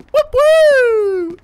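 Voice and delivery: high, loud siren wail in the voice; mock police siren. Playing cop, mimics a siren with a loud high whoop.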